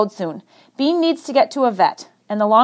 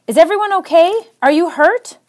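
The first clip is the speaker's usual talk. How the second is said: raised, higher call of concern; yelling; 7 semitones higher, 3 dB louder than usual. Acted. Chris yells to the team as they approach, raised and worried. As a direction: call out anxiously across the snow. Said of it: "Chris stands and yells".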